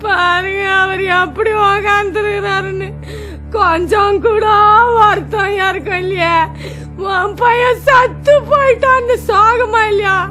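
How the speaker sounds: tearful keening over a lost son; wailing lament. Acted. Mother wails over her dead son, tearful lament. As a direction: mourn aloud, voice breaking now and then.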